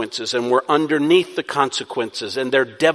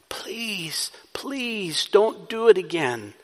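hushed, higher-pitched entreaty rising from a whisper; pleading; 5 semitones higher, 4 dB quieter, slower than usual. Shown as a demonstration. Preacher begging a sinner to stop, hushed pleading. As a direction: beg softly, drawing out each please.

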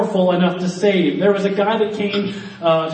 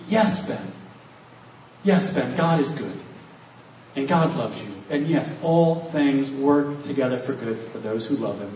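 softer, lower, gentle reassurance; reassuring scripture; 5 semitones lower, 6 dB quieter than usual. Acted. Bible speaking gently to Ben in his doubts, tender and reassuring. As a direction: speak it like comfort to a doubting friend.

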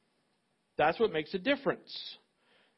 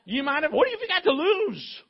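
much higher and louder, laughing as he insists; laughing excited insistence to a man in a hospital bed; 12 semitones higher, 5 dB louder than usual. Retold or imitated. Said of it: "I said".

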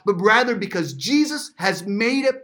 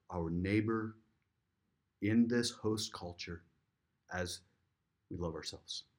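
prayer; 10 semitones lower, 11 dB quieter than usual. Acted. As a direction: pray it gently, with long pauses. Pastor leads closing prayer.

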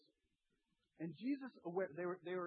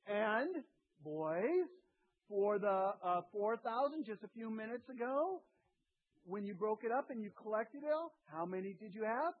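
louder, a touch higher, leading them on; pressing question; 5 semitones higher, 6 dB louder than usual. Acted. Preacher voicing Jesus questioning the boys, louder and pressing. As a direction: press them toward the answer.

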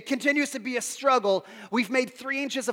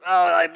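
exhausted groan; 4 semitones lower, 9 dB louder than usual. Retold or imitated. Exhausted groan in retold moment.

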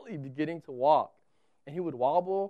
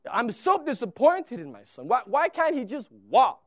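louder, hammed-up complaint about the wife's family; mock blaming dad; 5 semitones higher, 11 dB louder than usual. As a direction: pretend huffy father passing the blame.

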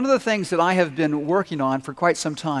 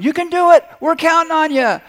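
high, loud cheering from the dugout; team shouting; 12 semitones higher, 9 dB louder than usual. Retold or imitated. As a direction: yell encouragement to the batter. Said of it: "hear your team shouting".

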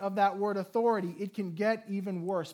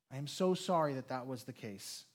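low, soft, stress on "so"; mean every word of "so sorry"; sincere sorrow; 7 semitones lower, 6 dB quieter than usual. Real, not acted. Pastor apologizing.

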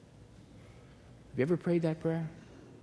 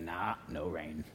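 lightly voiced servant; 8 semitones lower, 9 dB quieter, slower than usual. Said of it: "servant's reply in the story".

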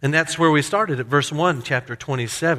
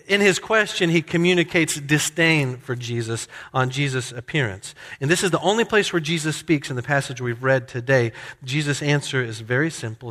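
ordinary talk

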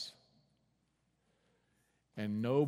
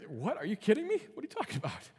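disbelief; 10 semitones higher, 4 dB louder, faster than usual. Retold or imitated. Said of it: "You, you could hear".

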